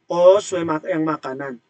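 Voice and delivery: usual speaking voice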